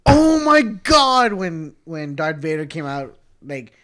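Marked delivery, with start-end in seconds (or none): 0.1-1.3 high, loud, slow "oh my God"; awed gasp. Retold or imitated. Reliving Darth Vader's entrance in the theater, a slow awed cry. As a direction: stretch it out in awe. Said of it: "were the only ones that were like".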